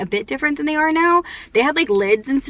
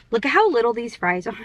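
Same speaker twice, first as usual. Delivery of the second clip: ordinary delivery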